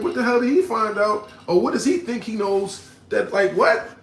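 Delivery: high, loud bewildered questions; confused inner panic. Retold or imitated. In court hearing the lawyer, high confused disbelief. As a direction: replay the shock of the moment. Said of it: "I'm like".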